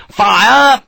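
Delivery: a loud, high one-word order; shout. Acted. Sharp orders the volley, shouting. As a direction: bark it down the line. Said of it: "Sharp called".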